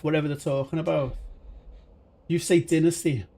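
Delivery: ordinary delivery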